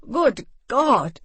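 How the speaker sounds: loud pained howl. Acted. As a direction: howl it out in pain. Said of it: "he howled".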